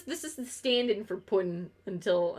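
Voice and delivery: ordinary talk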